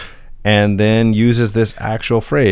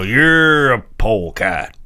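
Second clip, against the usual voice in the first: Foghorn Leghorn; slower than usual. Retold or imitated. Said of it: "And he would go".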